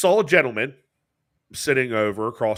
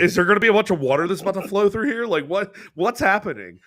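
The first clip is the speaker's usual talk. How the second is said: mock panic; 8 semitones higher, 4 dB louder than usual. Panicked man retold.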